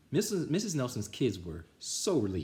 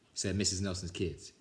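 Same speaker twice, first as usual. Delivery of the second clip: ordinary delivery, nothing marked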